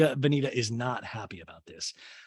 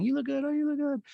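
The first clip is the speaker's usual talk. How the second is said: high-pitched tipsy compliments; tipsy gushing; 12 semitones higher, faster than usual. Retold or imitated. Host imitating a tipsy guest complimenting friends, tipsy gushing. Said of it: "she seems tipsy".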